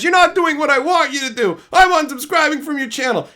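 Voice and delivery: high, loud bratty whine; mock petulant subscriber. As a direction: sulk it out like a spoiled fan. Mocking a quitting subscriber.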